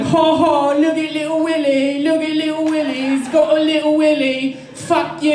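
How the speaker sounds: put-on high, sing-song kid taunt; mocking British bullies. Bullies taunting the French kid, high sing-song mockery. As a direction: chant it like a playground bully. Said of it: "they'd be like".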